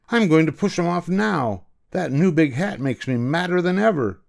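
high, angry duke ranting; furious. Acted. Duke raging at the hat, high angry voice.

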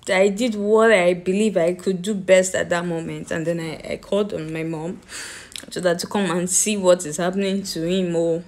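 normal talking voice

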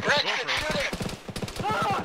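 high, slow shouted command; urgent order. Real, not acted. Leader ordering Rex to shoot the vehicle, urgent shout. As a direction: yell it at Rex to fire now.

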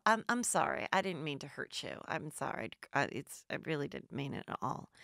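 soft mock apology. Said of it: "Sutton's like".

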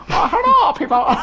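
high, loud greeting in a mock Chinese accent. Imagining Aaron greeting people in the shop, mock Chinese accent. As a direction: burst out in an exaggerated Chinese accent. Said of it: "develop the accent with them".

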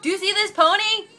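high, loud, amazed squeal; thrilled, high-pitched showing off; excited exclamation. Real, not acted. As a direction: squeal it as you show off a treasure. Showing off the horse to viewers.

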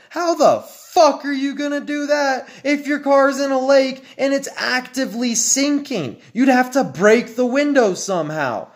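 high, heated rant at the design; building outrage, voice climbing; rising rant. Real, not acted. Ranting to viewers about Ford's trapped back seat, high and heated.

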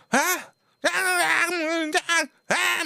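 sharp high yelled "あ？" over and over; angry shouting. Retold or imitated. Shop owner yelling at his part-timer in the kitchen, furious high shouts. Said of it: "みたいな感じで言い合ってて".

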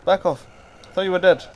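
high, loud warning to back off; startled shout. Real, not acted. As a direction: shout in alarm at a surprise attacker. Surprised by a zombie attack.

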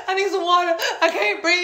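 high, loud, breathless mock panic; hammed-up tearful plea; imitated crying. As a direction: gasp it out like a tearful meltdown. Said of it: "I'm crying".

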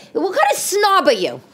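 high, mocking mom voice; scolding mother. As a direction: play a disbelieving mother. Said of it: "she'd probably be like".

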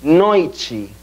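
NOITE as "NOY-chee"; Portuguese pronunciation. Shown as a demonstration. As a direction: glide oi, then "chee" to end NOITE. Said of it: "Repitan ustedes".

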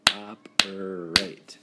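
"Operate" slowly, syllable by syllable: op-er-ate; slow, split into syllables. Shown as a demonstration. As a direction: clap out each syllable of "Operate". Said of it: "Three syllables".

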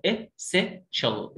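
essential very slowly in separate pieces; syllable by syllable. Shown as a demonstration. Teacher shows the word's parts, essential syllable by syllable. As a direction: break essential up: "e... sen... shal".

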